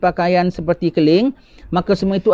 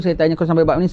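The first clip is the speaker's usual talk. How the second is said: ordinary talk, nothing marked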